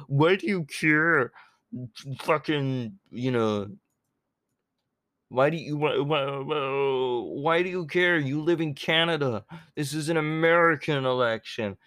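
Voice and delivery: mock the critics' question. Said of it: "When they say".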